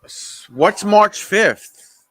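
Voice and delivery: loud, high and drawn out slowly; a loud, slow, high what's March fifth; baffled outcry. Real, not acted. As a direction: blurt it out in loud disbelief. Baffled at an announced date.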